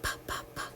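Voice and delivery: unvoiced pa pa pa like a train; breathy puffs for train sound; whispered puffing p. Shown as a demonstration. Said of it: "Or does K go with the train?".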